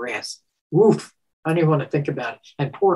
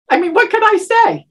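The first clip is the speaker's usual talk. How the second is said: high loud exasperation; 6 dB louder than usual. Real, not acted. Laughing at her own awkwardness.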